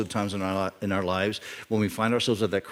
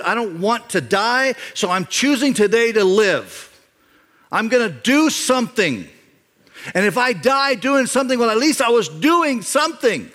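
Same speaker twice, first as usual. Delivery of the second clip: high, loud declaration, building higher; fired-up resolve; 11 semitones higher, 5 dB louder than usual. Acted. Preacher voicing Jonathan choosing to act, impassioned resolve. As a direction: declare it with rising conviction.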